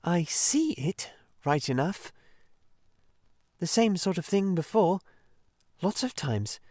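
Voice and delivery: a doubtful Mole who doesn't get it; Mole, unimpressed. Acted. Mole's voice, unimpressed.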